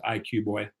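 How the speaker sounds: normal talking voice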